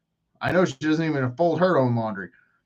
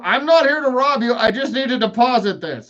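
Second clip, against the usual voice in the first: mock alarm; 8 semitones higher than usual. Acted plea to bank teller.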